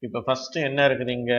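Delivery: ordinary talk, nothing marked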